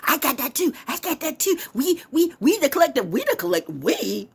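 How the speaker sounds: high, mocking copycat voice; mocking fakers. Mocking people who fake belonging, high sneering voice. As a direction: sneer as the hangers-on. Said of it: "everybody can fake like".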